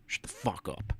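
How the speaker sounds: unvoiced whispered aside; muttered whisper to himself. Real, not acted. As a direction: hiss it under your breath.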